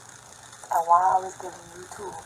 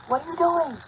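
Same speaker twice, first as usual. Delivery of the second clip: high-pitched, raised-voice yell; retold yell; 11 semitones higher, 6 dB louder than usual. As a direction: mimic a stranger yelling at you. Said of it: "He yells at me".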